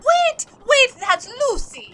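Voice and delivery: high, loud cry to stop; urgent shout. Acted. Crewmate stopping the chef from killing his rat, shouting.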